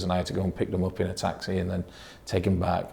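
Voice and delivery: ordinary talk, nothing marked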